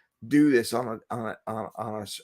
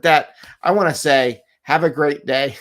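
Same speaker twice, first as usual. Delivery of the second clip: bright, loud farewell; a cheerful host wrapping up the show; cheery sign-off; 3 semitones higher, 8 dB louder than usual. Shown as a demonstration. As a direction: beam out a big upbeat goodbye. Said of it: "That was a rough reflection".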